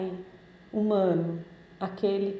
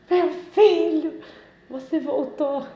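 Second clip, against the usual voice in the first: loud, high, slow joyful cry of welcome; a father flinging his arms round his lost son; overjoyed father; 7 semitones higher, 8 dB louder, slower than usual. Retold or imitated. Prodigal son's father welcoming him home, overjoyed and loud. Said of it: "E o pai".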